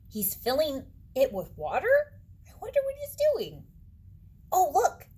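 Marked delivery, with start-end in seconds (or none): none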